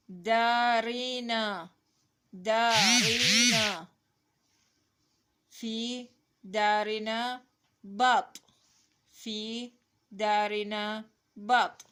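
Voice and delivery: loud, drawn-out word-by-word reading; slow model reading. Shown as a demonstration. Teacher reading a sentence to children, slow model reading. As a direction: stretch the long vowels, pause between words.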